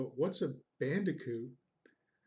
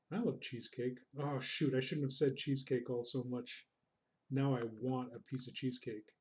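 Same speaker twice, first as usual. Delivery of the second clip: ordinary talk, nothing marked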